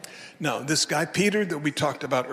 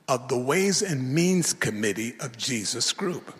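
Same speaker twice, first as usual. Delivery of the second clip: ordinary talk, nothing marked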